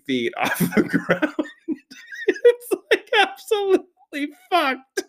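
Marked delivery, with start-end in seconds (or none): 2.3-5.0 very high, slow disbelieving squeal; exasperated squeal. Retold or imitated. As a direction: stretch it out in high-pitched disbelief. Trunk three feet off the ground.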